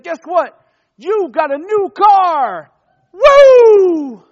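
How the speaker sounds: high, loud whoop of joy; excited. Pretend. Youth pastor acting out winning a car, shouting excitedly. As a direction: cheer like you just won a car.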